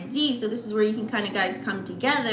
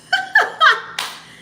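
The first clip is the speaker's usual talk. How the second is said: high, loud burst of laughter; laughing; 7 dB louder than usual. Real, not acted. Laughs at her own joke.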